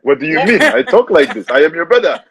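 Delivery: insistent put-on African accent. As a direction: protest innocently in an African accent. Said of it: "my accent".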